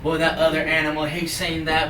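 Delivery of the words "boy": usual speaking voice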